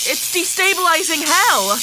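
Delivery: alarmed. Acted. Machine out of control.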